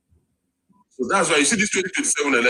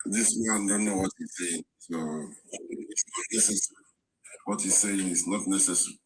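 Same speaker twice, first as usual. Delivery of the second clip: whispered aside; 5 semitones lower, 9 dB quieter than usual. Real, not acted. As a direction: hush it as a private aside to a neighbour. Whispered aside about another speaker.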